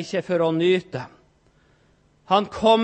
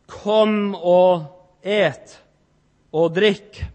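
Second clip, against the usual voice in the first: drawn-out invitation; much slower than usual. Acted. Preacher voicing Jesus' invitation.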